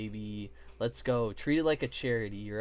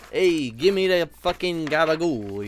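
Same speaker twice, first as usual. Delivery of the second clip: mock mobster; 3 semitones higher, 9 dB louder than usual. Streamer to Irish chat, loud mock mobster demand. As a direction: ham up a Jersey mobster asking for gabagoo.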